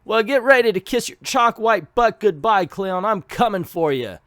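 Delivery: lightly voiced thug asking a lady for help; tough guy voice. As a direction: play a cocky trapped thug. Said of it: "I'm not, by the way, trying the accent".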